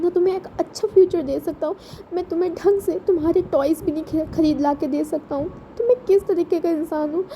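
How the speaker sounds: grieving big brother, voice up high; despairing brother. Acted. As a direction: beg forgiveness for having nothing to give. Wing confesses his helplessness.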